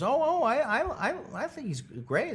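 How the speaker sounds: lightly voiced eager fan, high start; fawning guy. A hint of an eager fan praising Leno. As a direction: gush a little, starting high. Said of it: "He goes".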